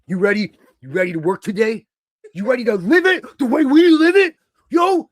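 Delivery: building manic hype shout; intense Moxley hype. Retold or imitated. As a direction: whip him up like a manic locker-room preacher. Voicing Moxley's intense pep talk.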